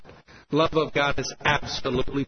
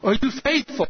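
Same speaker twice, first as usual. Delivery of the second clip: high-pitched questioning wife; Yvette; 8 semitones higher than usual. Acted. French wife questions her husband's faithfulness, higher voice. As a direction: press the question in a raised pitch. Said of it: "And she says".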